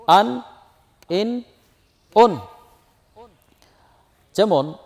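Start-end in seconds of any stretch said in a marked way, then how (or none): 0.1-2.4 short, clipped-off an, in, un said briskly and a bit louder; quick tanween syllables. Shown as a demonstration. Teacher drilling students on tanween, brisk short syllables. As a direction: snap out each tanween sound quickly. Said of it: "তানউইনের উচ্চারণ তাড়াতাড়ি করতে হয়".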